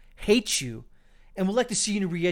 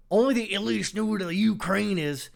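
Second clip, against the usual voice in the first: host parroting the panelist's mock Southern voice, pitched higher; imitating the mock accent; 5 semitones higher, 4 dB louder than usual. Host replaying the panelist's line to his audience, in a mocking imitation. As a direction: echo the sneer back as a mocking imitation. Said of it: "leave the jokes to the people who know how to do jokes".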